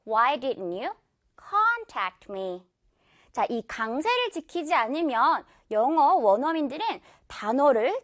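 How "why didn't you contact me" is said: very slow question, contact starting strong; stress on CON. Shown as a demonstration. Teacher repeats the example, slow with front-stressed contact. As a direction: lean on con, drop lower for tact me. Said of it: "con 부분의 강세".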